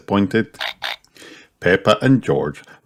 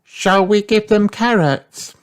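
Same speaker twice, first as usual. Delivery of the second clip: put-on very high child rabbit voice; Rebecca Rabbit. A narrator's reading. Rabbit child suggesting duck food, high-pitched asking. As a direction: ask in a high, little-creature voice.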